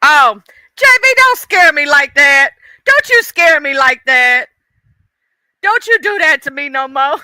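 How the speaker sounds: half-laughing, half-panicked telling off; relieved loud scolding. Real, not acted. Streamer scolding Mister J B in chat, loud and relieved.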